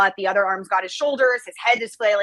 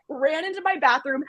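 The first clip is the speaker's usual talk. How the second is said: usual speaking voice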